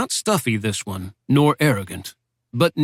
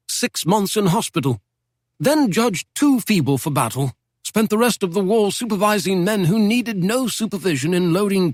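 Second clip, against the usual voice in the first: put-on a lightly raised, genteel old don's voice; old British professor; 7 semitones higher than usual. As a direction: speak as a wry Oxford professor reminiscing.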